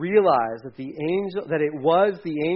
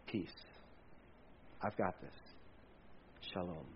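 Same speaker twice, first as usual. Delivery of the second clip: much softer, lower hushed comfort; calm a frightened man, barely above a breath; gentle reassurance; 10 semitones lower, 23 dB quieter than usual. Acted. The Lord calming terrified Gideon, hushed and tender. God answers Gideon's doubt.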